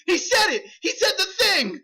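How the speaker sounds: very high, loud fan squeal; hammed-up giddy delight; mock audience excitement. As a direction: shriek like a thrilled studio audience. Plays audience thrilled at catchphrase.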